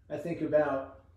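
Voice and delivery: ordinary talk, nothing marked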